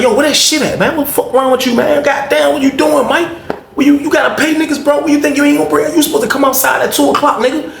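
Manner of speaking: high, heated yelling at the guy; angry demand. Retold or imitated. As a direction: chew him out for the missing pack. Friend K angrily demanding the weed.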